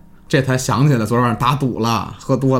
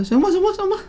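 high-pitched, brushing-off acted quote; mock impatience; faster than usual. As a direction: play at being fed up and giving in. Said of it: "老王就假装不耐烦了". (假装不耐烦)

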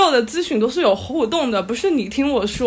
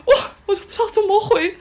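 laughing helplessly; 9 semitones higher, faster than usual. Real, not acted. Recalling awkward speechless moment.

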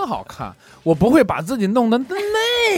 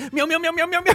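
high, kittenish meowing; mock cat mewing; cute meow; 9 semitones higher than usual. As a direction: mew like a cat to win her over. Imitating husband meowing while tickling.

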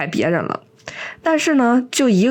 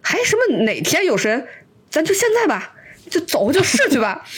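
eager retold voice pitched up high, jumping to a peak; excited; 7 semitones higher than usual. Auntie Sun eagerly agrees to try it with old Liu, mock excited. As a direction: blurt it out eagerly, hamming up her excitement. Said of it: "孙阿姨非常激动的站起来说".

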